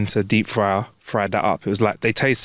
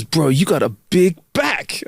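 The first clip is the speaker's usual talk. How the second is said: catcalling stranger; 5 semitones higher, much slower than usual. Retold or imitated. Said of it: "He goes".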